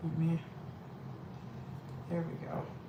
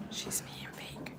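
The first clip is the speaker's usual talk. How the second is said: whispered aside; 15 dB quieter, slower than usual. Real, not acted. Aside about the daughter.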